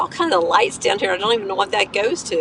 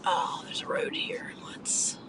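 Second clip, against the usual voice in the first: whispering; 11 dB quieter, slower than usual. Real, not acted. Driving, whispering to self.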